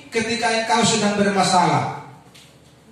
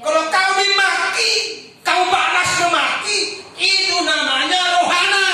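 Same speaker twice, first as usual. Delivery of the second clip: preaching at full force; 8 semitones higher than usual. Acted. Preacher urging the church to bless those who curse, fervent. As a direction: pour it out to the congregation.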